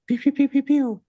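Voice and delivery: high playful laser pews; mock shots firing off trades; kid-style pew pew, high and quick; pew sound effects. Mimicking rapid trade executions.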